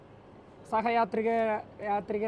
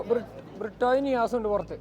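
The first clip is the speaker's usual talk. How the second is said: normal talking voice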